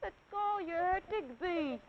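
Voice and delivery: high, annoyed little elephant; annoyed child. Acted. Elephant's child caught by the nose, high annoyed voice. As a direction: whine it out, hurt and cross. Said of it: "most annoyed".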